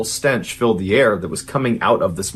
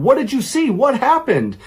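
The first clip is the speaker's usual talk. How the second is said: frantic, much higher pitched questions; panicked questions; 13 semitones higher than usual. Retold or imitated. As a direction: demand answers in a panic. Daughter pleads after mom's scream.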